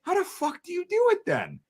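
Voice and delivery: way up high in disbelief; genuinely baffled, pitch shooting up; incredulous. Retold or imitated. Soldier pressing the corpsman on how he manages, high and disbelieving. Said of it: "I'm like".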